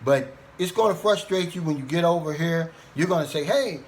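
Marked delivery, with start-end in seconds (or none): none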